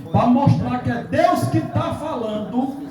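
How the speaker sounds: imitating a solemn pronouncement; retold prophetic declaration. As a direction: stretch each word out like a prophecy. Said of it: "Aí ele foi e disse assim".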